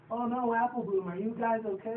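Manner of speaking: a hint of an anxious big sister; worried Applejack. Applejack worried about fillies.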